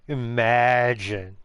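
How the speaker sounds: long drawn-out mock wonder; sarcastic rainbow "imagine". Doing the SpongeBob imagine with the rainbow, sarcastically drawn out. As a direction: stretch it out slowly like a sarcastic TV catchphrase. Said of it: "let's do the imagine with the rainbow".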